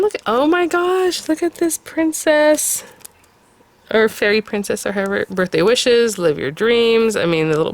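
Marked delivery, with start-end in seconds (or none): 0.0-2.8 high, stretched-out squeal of delight; delighted gush. Real, not acted. Crafter shows viewers the princess, delighted gush. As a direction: gush way up high, lingering on the words. Gushing over princess design.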